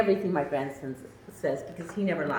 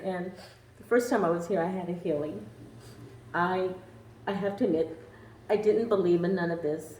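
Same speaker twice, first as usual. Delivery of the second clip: ordinary talk, nothing marked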